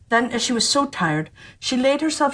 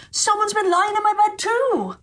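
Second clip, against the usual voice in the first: much higher, squeaky dwarf chorus; dwarfs calling out; 13 semitones higher than usual. Acted. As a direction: call out in tiny high dwarf voices. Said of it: "each called out".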